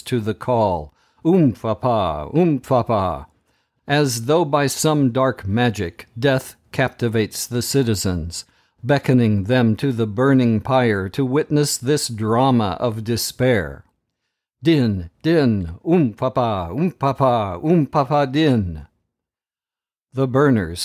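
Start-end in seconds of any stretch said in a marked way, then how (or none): 1.2-3.3 chanted drum rhythm in an altered voice; drumbeat chant. A narrator's reading. Drums calling the city, voiced as a chant. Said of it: "sound of hearing of the drums". 14.6-18.9 steady drum rhythm in an altered voice; drumbeat chant. A narrator's reading. Drums summoning citizens, voiced as a chant.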